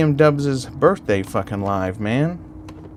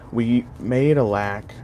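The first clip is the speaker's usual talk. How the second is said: usual speaking voice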